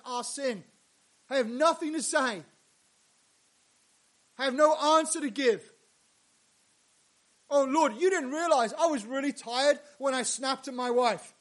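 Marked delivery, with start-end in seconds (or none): 1.3-5.7 loud confession. Acted. Confessing before God, loud. 7.5-11.3 pleading excuse to God, loud; whiny excuse. Retold or imitated. A sinner excusing himself to God, whining. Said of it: "excuse spoken to God".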